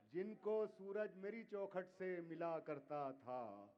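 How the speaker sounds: measured, declaiming poetry to audience; ghazal recitation. Acted. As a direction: recite each couplet with weight. Poet recites next couplet.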